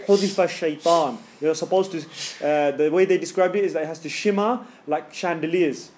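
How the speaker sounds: usual speaking voice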